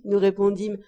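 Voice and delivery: ordinary delivery, nothing marked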